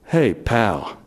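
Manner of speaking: a cop letting him off, low and casual; gruff policeman. Retold or imitated. Policeman dismisses [name] after the arrest: low, casual. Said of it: "A policeman comes and pats me on the back and says".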